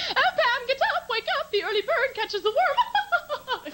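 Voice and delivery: high, sugary chirp, early-morning wake-up call; a mother singing her daughter awake in a very high voice; Glinda the Good Witch voice. Retold or imitated. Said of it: "like Glinda the Good Witch from hell".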